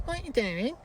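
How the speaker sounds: high, sing-song what you doing; catchphrase again. Retold or imitated. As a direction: tease with the same call. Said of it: "I don't like the way you say".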